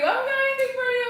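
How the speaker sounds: very high, loud, fast squeal; squealed pet talk. Real, not acted. Apologizing to the dog for no treat, squealed pet talk.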